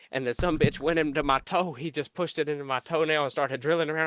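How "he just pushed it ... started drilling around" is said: ordinary delivery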